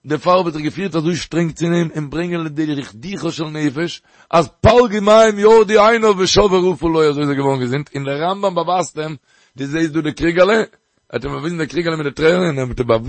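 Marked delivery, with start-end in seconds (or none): 4.3-7.1 loud, high, slowed preaching cry; impassioned preaching. Acted. Preacher driving home the lesson, loud and high. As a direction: call it out to the listeners with full force.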